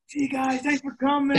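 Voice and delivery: teasing send-off to the hostile fans; cheeky farewell. Retold or imitated. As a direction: wave off the hecklers sweetly. Mock farewell to hecklers.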